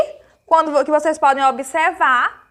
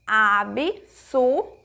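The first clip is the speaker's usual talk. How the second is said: slow syllables; 4 semitones lower, much slower than usual. Shown as a demonstration. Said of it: "Nós abrimos a boquinha três vezes".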